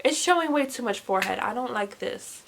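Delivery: ordinary talk, nothing marked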